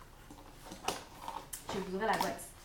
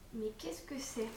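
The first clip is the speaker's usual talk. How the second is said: whisper; 3 semitones lower, 8 dB quieter than usual. Real, not acted. Puzzled reading a product label.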